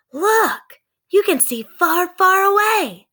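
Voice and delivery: high, loud amazed exclaim. Acted. Sister amazed at the treehouse view, high loud.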